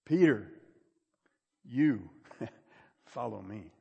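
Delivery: "you" standing alone between long pauses; stress on you. Retold or imitated. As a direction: pause before and after "you" to point it at the listener. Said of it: "He was emphasizing the word "you".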